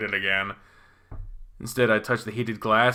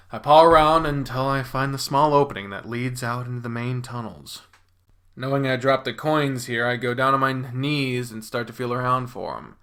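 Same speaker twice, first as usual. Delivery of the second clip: ordinary delivery, nothing marked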